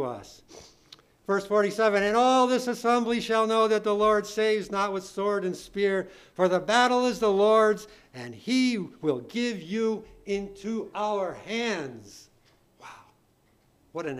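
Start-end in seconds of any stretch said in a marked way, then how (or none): 2.0-12.3 loud Bible reading, slowing at the close; declaimed scripture reading. Real, not acted. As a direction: proclaim it, then spell out the last clause slowly. Reads scripture aloud with force.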